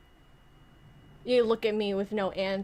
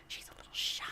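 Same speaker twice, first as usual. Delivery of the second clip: whispered aside; 13 dB quieter, faster than usual. Real, not acted.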